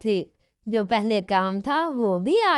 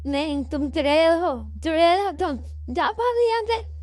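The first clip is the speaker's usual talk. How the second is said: high cartoon voice crying witch and go away; frightened bird chasing off a ghost; scared shooing; 6 semitones higher than usual. Kallu shoos the ghost away. (ऊँची डरी आवाज़ में भगाना)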